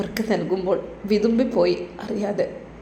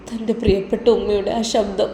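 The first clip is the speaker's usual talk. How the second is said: usual speaking voice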